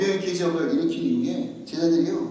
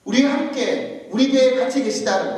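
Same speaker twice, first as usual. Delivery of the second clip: higher, insistent pulpit delivery; forceful preaching; 5 semitones higher, 6 dB louder than usual. Real, not acted. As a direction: press each point home to the congregation. (힘주어 높인 설교조)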